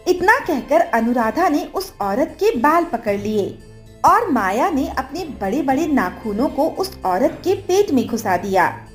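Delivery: ordinary delivery, nothing marked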